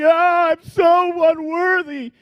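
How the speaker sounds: shouted, slow, mock-tragic wail; hammed-up cry of unworthiness, high and loud; drama queen. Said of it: "a little bit of a drama queen".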